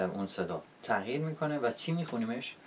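normal talking voice

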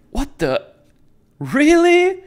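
high disbelief jumping to a loud squeal; imitating a baffled reaction; mock incredulous. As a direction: pitch "really" way up in disbelief. Ladybug's reaction to absurd death.

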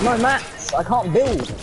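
very high, loud panicked cry; panicked shout. Real, not acted. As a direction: yell it out in sheer in-game panic. Panicking without building materials.

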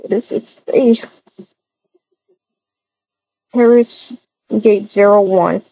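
ordinary talk, nothing marked

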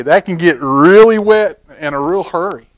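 ordinary delivery, nothing marked

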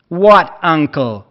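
armed homeowner yelling at the stranger in anger; angry shout. A narrator's reading. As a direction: snap it back in disbelief. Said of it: "rief er wütend".

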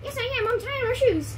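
very high squeaky voice; chipmunk voice. Acted. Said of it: "I'm a chipmunk".

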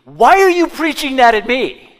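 hammed-up indignant yell; angry shout. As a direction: shout it like someone who feels exposed. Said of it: "I've had people look at me and say to me".

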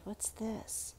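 secretive whisper. Real, not acted. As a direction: whisper it like a discovery. Whispered discovery in picture.

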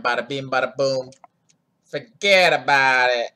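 put-on hammy Italian mobster accent. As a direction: ham up a New York Italian accent. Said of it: "My Italian accent is terrible".

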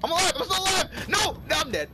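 high, loud panicked yelling; frantic shouting. Real, not acted. Gamer alive then suddenly dead in game, frantic shouting. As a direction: yell in alarm as it all goes wrong.

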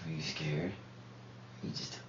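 very soft, low near-whisper; barely audible private questioning. Real, not acted. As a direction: keep it hushed and low. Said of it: "you whisper this".

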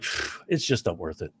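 low, quiet sigh of giving up; acted weary giving-up. Comic acting out being too lazy to bother, weary low sigh. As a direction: puff out, then drop low and soft, too lazy to bother.

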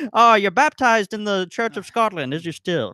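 a hammed-up Irish brogue at a Scottish baptism; Irish accent. Host jokes about Scottish stills in a mock Irish accent. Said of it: "This is my Irish accent in Scotland".

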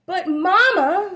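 a small calf begging mama to stay; little one pleading. A narrator's reading. Child calls to mother, high and pleading. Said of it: "said little one".

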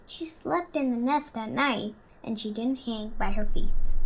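put-on lively storybook narration for children; story reading. A narrator's reading. Reading Stellaluna to the class, warm storytelling voice. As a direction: read it like story time for kids. Reads picture book aloud.

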